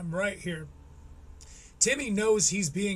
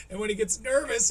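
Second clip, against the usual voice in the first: ordinary delivery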